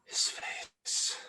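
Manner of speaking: hushed, breathy reveal of the face; dramatic whisper. A narrator's reading. Narrator whispering the reveal to the listeners. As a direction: drop to a whisper for the lightning reveal.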